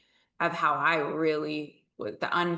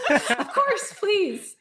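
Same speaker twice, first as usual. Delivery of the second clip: laughing through the words, much higher; 6 dB louder, much slower than usual. Real, not acted. Laughing as she echoes her friends. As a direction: giggle out the reply.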